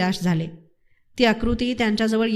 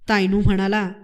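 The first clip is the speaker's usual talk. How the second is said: ordinary delivery, nothing marked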